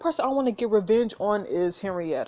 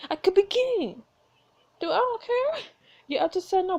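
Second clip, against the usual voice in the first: high-pitched; 11 semitones higher than usual. Real, not acted. Mocking the duke, then refusing.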